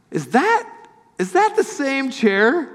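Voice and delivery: high, slow disbelieving question; surprised question. Retold or imitated. Friend spotting his old chair, high and astonished. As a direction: ask it as if you can't believe your eyes. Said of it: "And it looks something like this".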